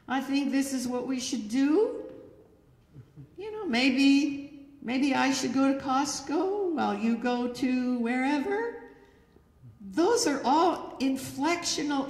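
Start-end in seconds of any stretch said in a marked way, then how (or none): 0.1-8.8 higher, tentative upspeak, lifting on "do", "maybe" and "wherever"; upward inflection. Acted. Wife musing about plans to husband, "wherever" rising as a question. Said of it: "your voice goes up on the end".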